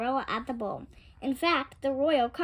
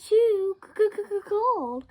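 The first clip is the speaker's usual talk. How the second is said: high stuttering shiver, teeth chattering; 9 semitones higher, 4 dB louder than usual. A narrator's reading. Little Boy Blue, freezing, stutters through chattering teeth. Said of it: "just shivered and quivered".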